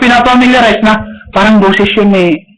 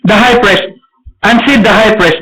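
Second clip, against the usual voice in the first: ordinary talk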